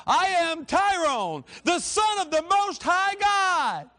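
loud, high, slow preacher's shout; full-force declaration, words drawn out; rallying proclamation. Shown as a demonstration. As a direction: lead the congregation in shouting it. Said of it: "say that with me".

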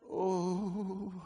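long held "oh"; sustained worshipful moan; moan in the spirit. Shown as a demonstration. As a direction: draw out a wordless moan. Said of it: "learning how to moan in the spirit".

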